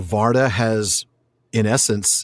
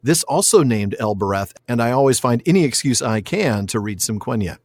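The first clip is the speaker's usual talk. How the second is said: ordinary delivery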